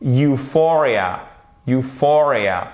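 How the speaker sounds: Euphoria slowly, with FOR stressed; stress on second syllable. Shown as a demonstration. Teacher illustrating the rule just explained, stressed second syllable. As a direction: draw out you-FOR-ia for Euphoria. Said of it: "sur notre deuxième syllabe".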